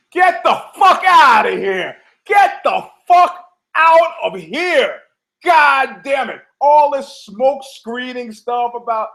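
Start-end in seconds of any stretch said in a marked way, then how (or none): 0.1-5.0 loud, high, slow scornful rant; exasperated outburst. Real, not acted. Podcaster scoffing at steroid excuses, loud and scornful. 5.4-6.4 loud frustrated yell; exasperated shout. Real, not acted. Podcaster fed up with the smoke screens, shouting.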